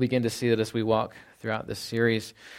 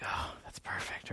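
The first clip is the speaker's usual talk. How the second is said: whispering; 16 dB quieter, slower than usual. Retold or imitated. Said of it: "I was like".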